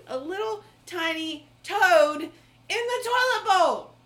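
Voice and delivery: loud, drawn-out disbelief; incredulous outburst. Real, not acted. Host reveals the toad to her friend, loud and incredulous.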